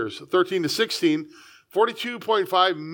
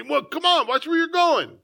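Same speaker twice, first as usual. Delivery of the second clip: high, indignant yell at a stranger; telling off a blind man, pitched high and indignant; mock angry shout; 8 semitones higher than usual. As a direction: snap at him like someone stepped on your toe. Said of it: "shout at him".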